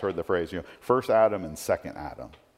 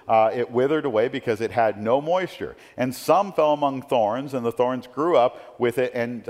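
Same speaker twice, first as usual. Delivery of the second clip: raised, proclaiming reading of the parable, a bit louder; scripture reading; 3 semitones higher, 4 dB louder than usual. Acted. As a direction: read the parable out to the congregation like a proclamation. Reads the parable aloud.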